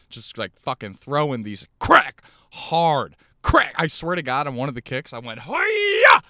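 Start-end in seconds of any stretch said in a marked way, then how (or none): 1.8-3.7 kick crack sounds. Retold or imitated. Reenacting kicks at the gym. 5.4-6.2 piercing high karate shout; loud kick yell. Retold or imitated. Comic reliving his first gym kick, screaming karate hi-ya. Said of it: "Just loud as fuck".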